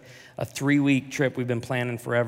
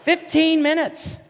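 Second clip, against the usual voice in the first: exasperated; 7 dB louder, slower than usual. Retold or imitated. Exasperated emphasis on duration.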